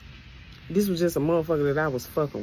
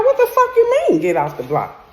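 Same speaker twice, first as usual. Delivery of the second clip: much higher, louder burst, dropping at the end; outraged shriek; 7 dB louder than usual. Retold or imitated. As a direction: shriek your disbelief at him. Outraged reply to his order.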